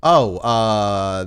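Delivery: usual speaking voice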